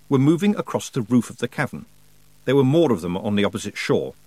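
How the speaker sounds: usual speaking voice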